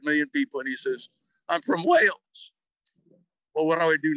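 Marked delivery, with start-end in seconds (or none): 1.5-2.5 higher, slow, emphatic retort; retold indignant correction. Golfer turning round live to correct the starter, emphatically. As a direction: imitate a player setting the record straight.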